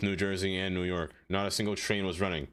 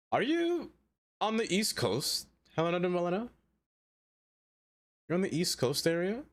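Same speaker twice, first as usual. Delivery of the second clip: high excited question; 8 semitones higher than usual. Real, not acted.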